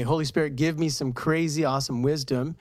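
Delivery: ordinary delivery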